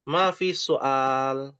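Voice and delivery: slow, deliberate teacher demo; slow model phrase. Shown as a demonstration. Teacher models the answer slowly for the class. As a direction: say it slowly so they can repeat. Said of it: "kalau nggak ada pertanyaan adalah mafi soal".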